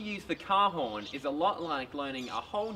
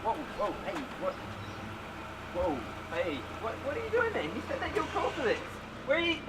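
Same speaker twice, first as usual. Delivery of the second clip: alarmed; 2 semitones higher, 4 dB quieter, faster than usual. Acted. Startled by being nearly run over.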